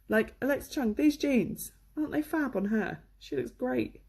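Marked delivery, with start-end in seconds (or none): none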